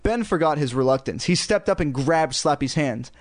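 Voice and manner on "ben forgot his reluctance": plain reading voice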